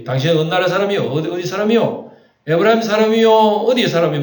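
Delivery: loud, high-pitched challenge, quick at first; challenging interrogation. Acted. Gilead guards question fugitives at the river crossing, challenging. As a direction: call it out like a guard questioning strangers.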